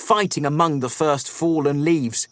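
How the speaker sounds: ordinary delivery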